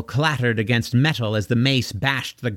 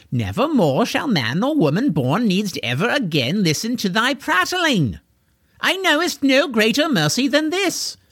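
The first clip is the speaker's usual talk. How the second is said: high, puffed-up knight voice gloating over his victim; a hammy villain knight relishing the kill; pompous knight; 9 semitones higher than usual. Acted. Corwin gloating over the pinned George, pompous knight.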